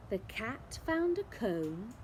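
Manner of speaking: ordinary delivery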